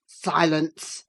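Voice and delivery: low stern command; exclaimed. A narrator's reading. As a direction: cut her off sharply. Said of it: "he exclaimed".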